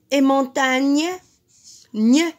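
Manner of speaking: gne shouted out on its own after montagne; loud rhyme sound. Shown as a demonstration. Teacher to class, stressing the rhyme ending gne loudly. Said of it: "qui rime avec pagne".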